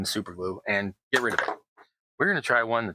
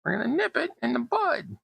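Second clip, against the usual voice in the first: much higher, in a comic deputy's voice; quick mock Barney Fife line; Barney Fife impression; 14 semitones higher than usual. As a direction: lay down the law like a fussy small-town deputy. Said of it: "immediately thought of Barney Fife".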